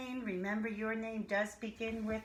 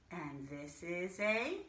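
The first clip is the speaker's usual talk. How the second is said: ordinary talk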